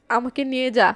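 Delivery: plain reading voice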